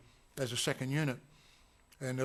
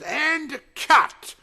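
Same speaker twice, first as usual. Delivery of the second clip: shouted command; 12 semitones higher, 12 dB louder than usual. Retold or imitated. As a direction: yell cut, much higher and louder. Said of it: "And David says".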